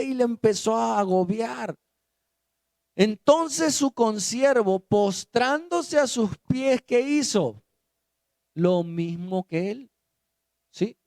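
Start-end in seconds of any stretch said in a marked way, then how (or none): none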